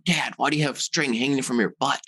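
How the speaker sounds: a hint of a puzzled child; young daughter. Three-year-old daughter questioning her dad, lightly imitated child voice. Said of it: "And my daughter comes running over".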